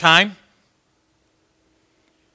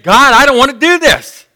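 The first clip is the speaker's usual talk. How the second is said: raised, higher pleading to God; 6 semitones higher, 6 dB louder than usual. Retold or imitated. Jesus begging God in the garden, louder and higher. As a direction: beg God to spare him. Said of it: "he's pleading".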